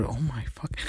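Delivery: hushed thinking-aloud mutter; muttering out loud. A narrator's reading. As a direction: mutter it under your breath. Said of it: "thinking and muttering out loud".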